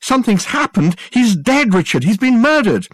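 high, loud, breaking news; distraught. A narrator's reading. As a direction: cry out the terrible news. Susan distraught on phone.

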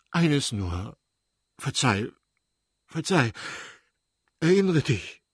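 begging forgiveness, rising in pitch; tearful pleading. A narrator's reading. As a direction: plead with your wife, close to tears. (flehend, den Tränen nahe)

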